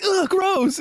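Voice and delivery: high squeaky grossed-out voice; disgusted. Retold or imitated. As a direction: recoil from something slimy. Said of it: "and he's like".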